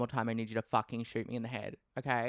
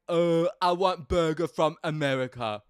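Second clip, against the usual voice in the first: loud, higher mock dim tourist; dumb tourist voice; 5 semitones higher, 12 dB louder than usual. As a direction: play a clueless burger-hungry tourist. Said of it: "I'm like".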